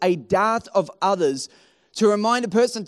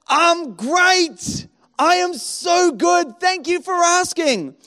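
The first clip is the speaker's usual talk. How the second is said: higher, slow, put-on bright tone; fake cheerful; 7 semitones higher, 3 dB louder, much slower than usual. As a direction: sell the fake happiness with a mock smile. Fake answer at church door.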